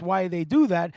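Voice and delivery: normal talking voice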